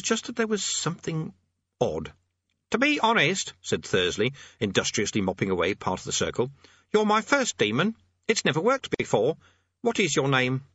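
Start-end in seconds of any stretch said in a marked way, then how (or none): 2.7-3.5 high-pitched eager character voice; fussy amateur demonologist. Acted. Thursley addressing his demon. 6.9-10.6 high-pitched eager character voice; fussy amateur demonologist. Acted.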